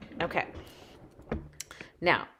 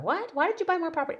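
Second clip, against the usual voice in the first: higher, incredulous mock question; mock viewer disbelief; 10 semitones higher, faster than usual. Viewers asking why she bought property, mock shocked. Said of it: "you're gonna be going".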